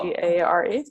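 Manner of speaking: D A R E, each letter said on its own; spelled out letter by letter. Shown as a demonstration. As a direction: spell dare out clearly for the listener. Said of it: "How do you spell?".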